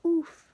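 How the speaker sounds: loud high Roblox oof grunt; oof sound. Shown as a demonstration. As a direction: punch out a sharp loud oof. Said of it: "we do the oof sound".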